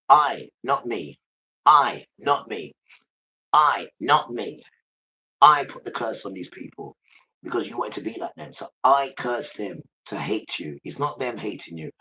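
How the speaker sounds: loud, slow punches on key words; emphatic preaching as God. Acted. God explaining the curse to followers, forceful and emphatic. Preaches in Father's first person.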